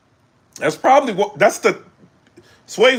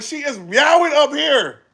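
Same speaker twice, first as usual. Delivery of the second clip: crowd shout; 10 semitones higher than usual. Retold or imitated. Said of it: "It was like".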